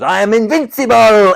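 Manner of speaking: hammed-up villain yell; villain boast. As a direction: bellow it like a cocky villain. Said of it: "the guy that goes".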